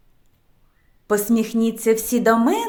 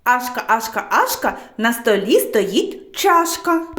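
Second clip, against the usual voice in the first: tongue-twister said quicker, without gaps; brisk run-through of a speech drill; faster. Shown as a demonstration. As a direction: run the rhyme straight through at a quicker pace. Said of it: "А тепер швидше".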